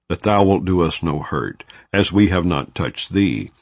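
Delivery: usual speaking voice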